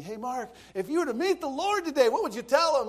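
high, loud imitation of an eager questioner; pastor's loud challenge. Youth pastor putting Mark on the spot, loud insistent voice.

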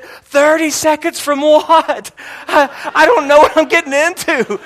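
high, nervous laughing question; mock panic. Chaplain panicking to the swat driver, nervous laughing. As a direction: play the scared rookie chaplain, half laughing. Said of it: "and I said".

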